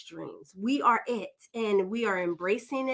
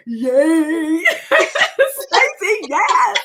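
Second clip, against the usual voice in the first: loud, high, gleeful cheering; squealing yay with a laugh; inner cheer; 6 semitones higher, 11 dB louder, much slower than usual. Retold or imitated. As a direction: let the secret joy burst out loud. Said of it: "inside going".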